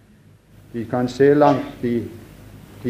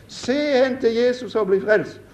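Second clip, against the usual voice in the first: high preacher's call; Methodist preacher calling; 8 semitones higher than usual. Retold or imitated. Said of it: "ropte han bare som en metodist kunne rope".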